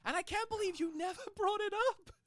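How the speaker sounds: very high, laughing disbelief; incredulous squeal. Real, not acted. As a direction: squeak it out in amused embarrassment. Embarrassed disbelief at the friend.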